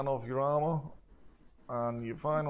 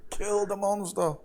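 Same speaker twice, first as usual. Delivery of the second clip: mock scream; 7 semitones higher than usual. Delirious acolyte urging on the fight, mock screaming. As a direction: play a screaming zealot, voice pushed up. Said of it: "He's screaming".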